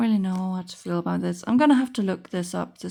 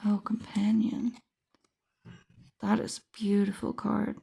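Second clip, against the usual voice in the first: whispering; 7 dB quieter than usual. Real, not acted. Quiet delighted aside to self.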